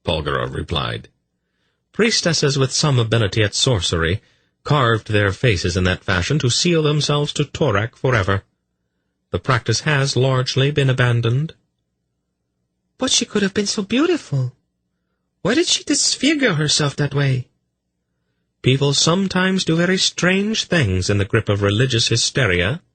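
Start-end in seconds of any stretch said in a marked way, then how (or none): 1.9-11.5 high, even female voice; Polgara, composed woman. Acted. Polgara explaining a temple custom, composed and high. 13.0-17.5 put-on high-pitched, dismayed girl. Girl upset over the disfigured priestess, high and dismayed. Horrified girl keeps asking. 18.6-22.8 high, even female voice; Polgara, composed woman. Acted. Polgara explaining a temple custom, composed and high.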